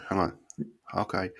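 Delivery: normal talking voice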